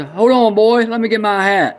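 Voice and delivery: high, loud and eager punchline; old man jumps at the chance. Acted. Old man rushes to join the boy, high eager voice.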